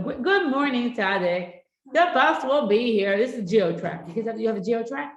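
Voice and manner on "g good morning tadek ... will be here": slow, high, loud sing-song; forced sweet wake-up. Retold or imitated. Mother trying a sweeter wake-up for son, slow sing-song.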